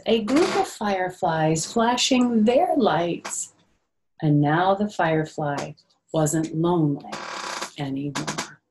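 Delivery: gentle, sing-song storybook reading; lightly lilting picture-book narration; reading to children. A narrator's reading. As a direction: read it like circle time for little ones.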